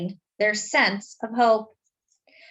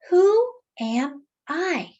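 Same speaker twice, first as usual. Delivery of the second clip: put-on slow, higher, lost young man asking himself; searching question; 5 semitones higher, much slower than usual. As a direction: ask it slowly, as if to yourself.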